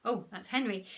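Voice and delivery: ordinary delivery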